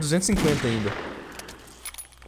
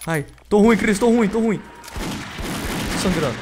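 alarmed; 6 semitones higher than usual. Real, not acted. Character badly hurt in game.

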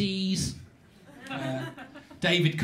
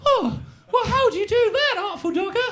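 very high, loud, naive voice; innocent boy Oliver; 12 semitones higher, 4 dB louder than usual. Acted. Newcomer asking the street boy how, naive and high. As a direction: ask it wide-eyed in a high child's voice. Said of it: "Oliver goes".

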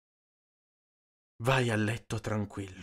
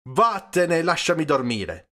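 loud, higher, exasperated command; cross outburst at raised pitch; irritated order; 8 semitones higher, 6 dB louder than usual. A narrator's reading. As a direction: send him packing loudly. Priest orders Peppone out.

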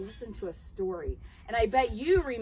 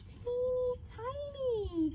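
tiny voice; 12 semitones higher, 4 dB quieter, slower than usual. Shown as a demonstration. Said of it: "teeny tiny voices".

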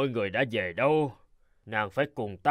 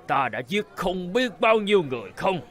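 higher, breaking into a tearful shout; anguished cry; 6 semitones higher than usual. Acted. Drunk groom confessing to his bride, anguished cry. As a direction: blurt it out half sobbing.

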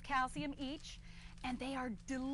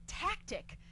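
Bush impression; 3 semitones lower, 6 dB quieter, slower than usual. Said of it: "Bush stood up ... and said".

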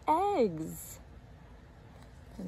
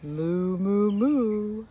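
cow's moo; 4 dB louder, much slower than usual. A narrator's reading. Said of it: "says Missus Cow".

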